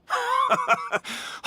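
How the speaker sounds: high, happy laugh of recognition; delighted laugh. Acted. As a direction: laugh with surprise at seeing her again. Reunion with former student.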